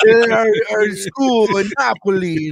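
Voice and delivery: high, slow, hammed-up Italian accent; mock Italian accent. Host mocking a commercial's Italian chef school, mock Italian accent. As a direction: stretch it out in a jokey Italian accent.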